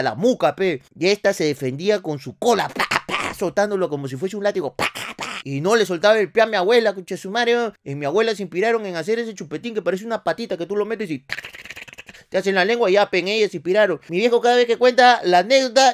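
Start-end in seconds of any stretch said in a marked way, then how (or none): none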